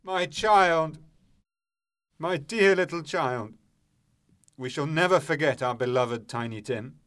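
high, loud wail of grief; bereaved father's outburst, easing slightly; sudden anguished cry. A narrator's reading. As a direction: cry out for a lost child. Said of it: "Then he cries out suddenly".

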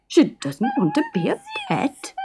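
high stunned repetition; shocked echo. A narrator's reading. Fred echoing the mermaid in horror, high voice. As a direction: echo it back in disbelief. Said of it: "echoed Fred".

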